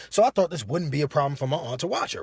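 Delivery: usual speaking voice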